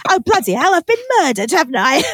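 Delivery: a touch of put-upon exasperation; mock mild annoyance. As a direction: shrug it off like a small nuisance.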